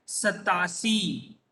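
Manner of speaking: सतासी drawn out slowly, syllable by syllable; teacher modelling सतासी slowly; slow pronunciation demo. Shown as a demonstration. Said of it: "इसका उच्चारण किस तरह हो जाता है?".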